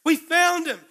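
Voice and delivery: loud, high and insistent; excited shout. Acted. Andrew insisting to his brother Simon, excited shout. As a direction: hammer the news home.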